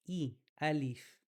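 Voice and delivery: I, alif with a clear, slow ee before the alif; clear kasra sound. Shown as a demonstration. Teacher demonstrating the kasra to students, slow and clear. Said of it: "you really gotta get the kasra out".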